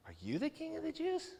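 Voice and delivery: hushed, incredulous "you", pitched high to the end; soft high questioning leaning on "you"; quietly probe, lifting from "you" onward; serious question. Acted. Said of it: "His question is serious".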